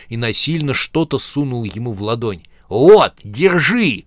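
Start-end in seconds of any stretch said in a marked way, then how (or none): 2.7-4.0 slow, much louder hiss. Acted. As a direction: shove it at him and hiss. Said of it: "прошипел мертвец".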